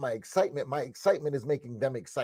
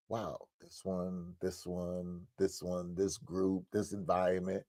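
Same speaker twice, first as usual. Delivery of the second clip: soft, slow, awed murmur; hushed inner wonder; 7 semitones lower, 10 dB quieter, slower than usual. Retold or imitated. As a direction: whisper it to yourself in wonder. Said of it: "I'm like".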